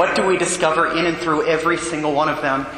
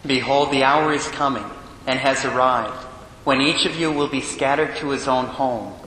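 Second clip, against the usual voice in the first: Gospel reading. Acted.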